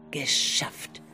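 normal talking voice

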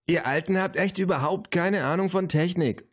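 put-on high-pitched exasperated girl; scornful Luisa. A narrator's reading. Luisa scorns the old folks. (genervtes Mädchen, hohe Stimme)

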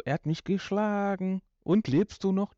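high, deadpan overacted line; flat mock storytelling. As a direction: parody a lifeless radio play. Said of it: "Und nicht so".

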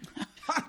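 short breathy mocking laugh; a quick contemptuous huff of a laugh; scornful laugh. Verächtliches Lachen. (verächtliches Lachen)

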